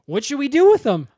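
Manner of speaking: loud, high call to a crowd; acted rabble-rouser putting a question to the kids; rallying call. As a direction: pitch it up and call it out. Acting Monica rallying the kids.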